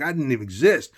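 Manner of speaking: ordinary talk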